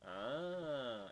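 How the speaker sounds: normal talking voice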